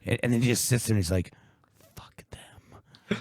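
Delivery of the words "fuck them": breathy hushed curse, barely voiced; whispered curse. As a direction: mutter it under your breath like a secret grudge. Retold grumpy cat? quiet curse.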